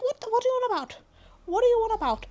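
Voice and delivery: high, incredulous what-are-you-on-about; baffled. Retold or imitated. Vlogger baffled at her own busyness, high incredulous. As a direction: squeal it in disbelief.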